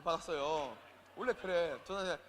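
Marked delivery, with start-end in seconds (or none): none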